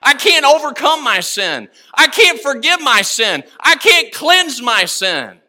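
re-enacted cry to the Lord, each phrase punched out. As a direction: preach it as an urgent, repeated confession. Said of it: "I cried unto the Lord".

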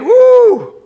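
high loud whoop; excited whoop. Real, not acted. Preacher whooping to the congregation mid-reading. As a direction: let out a joyful woo.

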